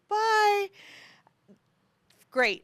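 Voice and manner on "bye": high, bright-hearted imitated child's bye; long, high, cheery mock farewell; happy child's goodbye. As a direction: imitate an oblivious, happy kid calling bye. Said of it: "she was so happy".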